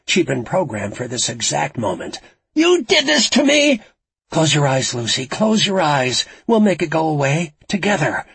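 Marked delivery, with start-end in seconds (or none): 2.5-3.8 high, loud hysterical scream; accusing scream. A narrator's reading. Lucy blames Frankie on the bridge, screaming. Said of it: "Lucy screamed, her voice rising over the music". 4.3-8.3 raised, louder urgent plea; urgent pleading. A narrator's reading. Frankie urges terrified Lucy to close her eyes, pleading.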